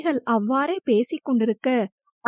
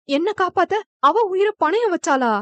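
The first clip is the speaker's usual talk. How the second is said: a higher, shocked gasp of disbelief; 6 semitones higher than usual. A narrator's reading. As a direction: ask it as if the news just hit you. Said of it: "என அதிர்ந்து போய் அர்ஷு கேட்ட". (அதிர்ச்சியுடன் உயர்ந்த குரலில்)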